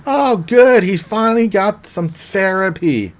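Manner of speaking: high, loud opening easing slightly; mock delighted jab. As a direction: act out gushing, teasing relief. Acted sarcastic retort between characters.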